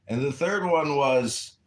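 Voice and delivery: ordinary talk, nothing marked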